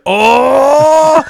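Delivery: loud, high drawn-out "ohh"; comic involuntary outburst; sudden cry mid-sentence. Acted. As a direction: blurt out a long, strained wail. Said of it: "mid sentence he'll just go".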